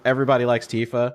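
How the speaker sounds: ordinary talk, nothing marked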